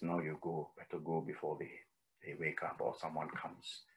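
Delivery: very low, hushed warning; barely audible, furtive urging; secretive urging. Retold or imitated. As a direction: send them off without waking the guards. Sita urges him away before guards wake.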